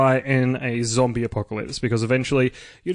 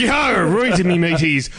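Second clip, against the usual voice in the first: pirate voice; 4 dB louder, much slower than usual. Pretend. Pirate voice to open segment.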